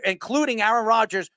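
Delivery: ordinary talk, nothing marked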